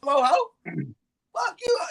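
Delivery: high, hammed-up yelling insult; mock angry yell at a woman. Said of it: "we get on their level".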